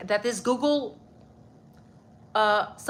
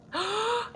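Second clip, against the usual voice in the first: sharp, high gasp of surprise; a quick thrilled intake of breath; delighted gasp; 12 semitones higher than usual. Real, not acted. Reacts to donation.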